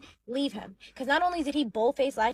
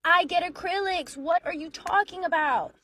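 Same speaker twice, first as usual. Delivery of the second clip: mock exasperated retort; 6 semitones higher, 4 dB louder than usual. Wife acted arguing back.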